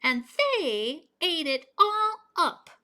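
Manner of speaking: high, slow, squeaky little bear voice; high squeaky Baby Bear. A narrator's reading. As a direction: squeak it out like a tiny cub. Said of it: "in her high, squeaky voice".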